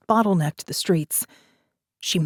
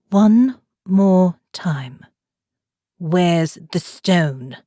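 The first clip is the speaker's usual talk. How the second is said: slow menacing demand; 3 dB louder, much slower than usual. A narrator's reading. Gun against biker, final demand.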